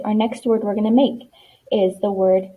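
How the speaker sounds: normal talking voice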